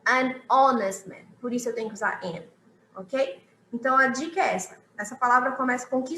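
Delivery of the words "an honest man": slow, careful "an honest man" with the H dropped; an honest man, silent H. Shown as a demonstration. Teacher models "an honest man" for students, slow and clear. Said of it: "vai ter o som da vogal O".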